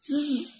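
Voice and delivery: bare buzzing "z", no vowel; weak form of 'is'. Shown as a demonstration. Teacher showing learners the weak form of is, a lone "z". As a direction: reduce it to just "z". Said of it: "the weak form of is".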